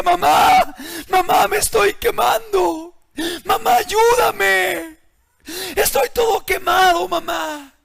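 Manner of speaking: high, loud sobbing plea for mother; desperate crying shout to mama; burning boy's cry. Acted. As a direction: cry out in pain and terror. Voicing burning boy calling mother.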